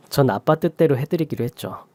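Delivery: ordinary delivery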